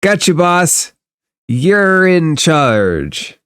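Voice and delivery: put-on slow, high, kid-like deference to the boss; little boy. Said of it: "and goes".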